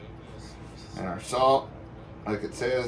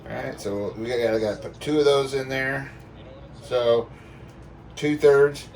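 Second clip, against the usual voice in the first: ordinary talk, nothing marked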